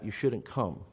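ordinary talk, nothing marked

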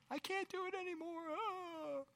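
high, crying whine of giving up; mock weepy falsetto; whiny quitter. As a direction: wail it like a quitter at the end of his rope. Whiny quitter voiced in sermon.

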